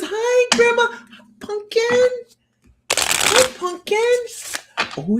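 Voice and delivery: put-on high, excited little child voice. A grandchild greeting Grandma about the zoo, high and excited. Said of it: "Edlin says".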